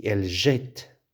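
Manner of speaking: "jette" with open è and a sounded final t. Shown as a demonstration. Teacher contrasting with jetons, open è in "jette". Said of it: "Mais ici".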